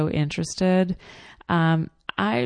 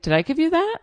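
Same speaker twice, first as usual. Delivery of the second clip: mock surprise; 11 semitones higher, 5 dB louder, faster than usual. Sales guy surprised.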